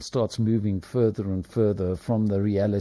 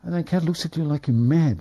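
ordinary delivery

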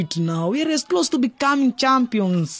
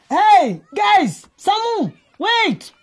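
high, loud call after friends; 11 semitones higher, 5 dB louder, much slower than usual. Acted. Ronnie calls after his friends, loud and high. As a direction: shout to catch them before they go.

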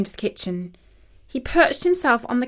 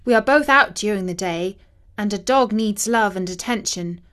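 ordinary delivery

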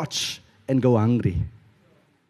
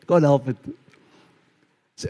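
normal talking voice

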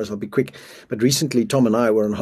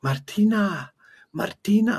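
mock scream of a name, higher; mild mock yell of a name, higher; retold mother's scream; 7 semitones higher, slower than usual. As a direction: call the lost child's name high, retelling a scream. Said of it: "all I can hear is the mother screaming".

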